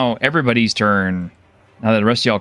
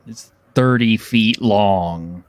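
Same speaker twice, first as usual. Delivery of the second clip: ordinary delivery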